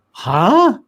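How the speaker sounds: loud, high-pitched mock-baffled "huh?"; baffled reaction. Host reacting to Biden's remark about his wife, loud mock bafflement. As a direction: blurt it out in exaggerated disbelief. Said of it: "it was just like".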